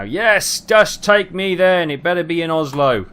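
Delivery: high, loud, slow exasperated plea. Real, not acted. Driver pleading with the game's tow, exasperated. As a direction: throw your hands up at the game.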